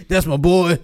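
laughing through a proud dad line; laughing imitation of a proud father. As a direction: say it while cracking up. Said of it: "It's like".

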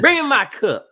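high, loud bossy grandma voice; grandma's demand. Retold or imitated. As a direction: bark it like a demanding old grandma. Grandma demanding her tobacco cup.

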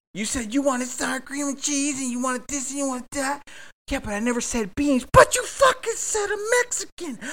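mock shouting match climbing to a shriek; heated argument. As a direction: screech it as the fight boils over. Escalating acted couple quarrel.

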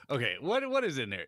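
ordinary talk

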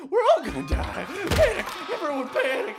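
loud, hammed-up panic; mock panic. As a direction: yell for everyone to panic. Zombie outbreak, urging panic.

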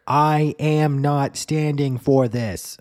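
high, loud, slow indignant declaration; dramatic outburst. Retold or imitated. Woman at the front of the DMV line refusing to wait, high loud slow protest. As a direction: draw out each word like a woman at the end of her rope.

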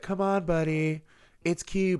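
soft, coaxing fake calm; forced gentle. Coaxing his son to bed, soft fake calm. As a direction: sweet-talk the kid while seething. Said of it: "you're trying to be like".

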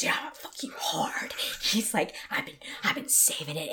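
whispered menacing line; menacing client. Retold or imitated. Burly client leaning into her face, whispered menace. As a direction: breathe it like a looming threat.

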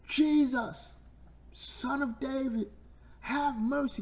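very high, desperate cry for mercy; high-pitched blind beggar's wail; screamed plea. Retold or imitated. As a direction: scream it out to someone passing in the street. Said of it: "He's screaming".

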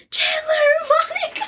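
high squealing laugh; excited laughing squeal. Real, not acted. Excited about reunion scene.

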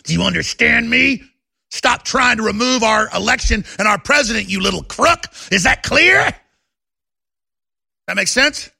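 host warning a congressman, angry and loud; angry threat. Pretend. Host threatening Nadler on air.

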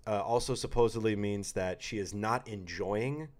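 normal talking voice

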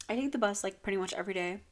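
ordinary talk, nothing marked

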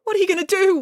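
put-on high, wailing child's cry; wailing girl. A narrator's reading. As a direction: wail in despair, very high. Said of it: "wailed Samantha".

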